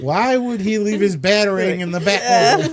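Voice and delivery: high, loud incredulous outburst; exasperated disbelief. Retold or imitated. Fan ranting about Batman's forgotten Batarang, loud disbelief. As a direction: throw the question up in loud disbelief. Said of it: "And I'm like".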